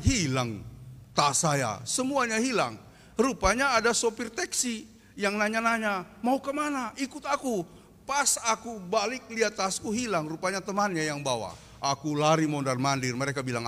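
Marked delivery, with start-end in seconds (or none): 6.3-7.6 pushy taxi driver. Retold or imitated. Taxi driver touting passenger.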